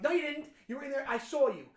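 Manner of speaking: loud, high accusing push-back; insisting the kid was there, mock-gangster anger; mafia henchman. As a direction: call him a liar like a mob heavy. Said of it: "turn into a mafia henchman".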